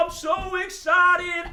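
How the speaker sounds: high, loud, drawn-out excitement; excited hound dog. Pretend. Puppet dog seeing a bunch of friends, wildly excited. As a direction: howl the words like a worked-up dog. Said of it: "he's so excited".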